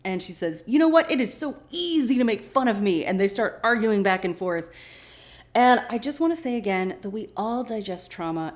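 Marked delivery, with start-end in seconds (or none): 0.7-3.0 high, loud mock outburst; hammed-up huffy quarrelling; indignant outburst. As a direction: fire back high and affronted. Acting Shannon's side of quarrel.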